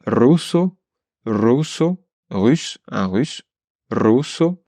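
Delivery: "russo" with a strong initial trilled r; rolled r. Shown as a demonstration. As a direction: roll the r in "russo". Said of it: "pour t'entraîner à prononcer".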